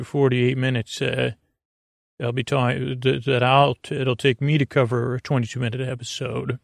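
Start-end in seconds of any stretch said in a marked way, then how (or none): none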